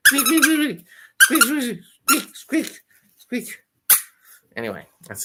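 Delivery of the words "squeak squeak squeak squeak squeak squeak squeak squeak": very high, loud squeak imitation; squeaky toy noises. Owner mimicking the dog's squeaky squirrel toy, high and loud.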